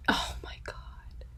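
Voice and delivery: choked, breathless whisper; real tearful whisper about a character. Real, not acted. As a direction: let it catch in the throat. Upset about Lip.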